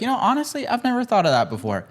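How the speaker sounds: high, open admission; humble reply. Pretend. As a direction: sound sincerely unsure. Humble nurse voiced to patient.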